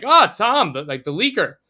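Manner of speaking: loud, high mock hello; teasing greeting. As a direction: tease him as the leaker. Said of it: "he was like".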